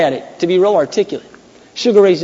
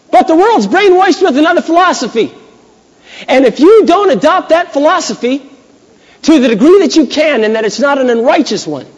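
high, loud preacher's climb; preaching at full force; 10 semitones higher, 6 dB louder than usual. Real, not acted. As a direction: lift it up and push it out to the congregation.

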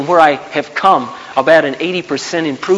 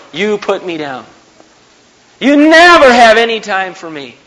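loud, high-pitched exasperated accusation; hammed-up marital complaint, punching the absolutes; accusing spouse; 7 semitones higher, 6 dB louder than usual. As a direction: act out a fed-up spouse laying blame. Acted marital quarrel, spouse accusing.

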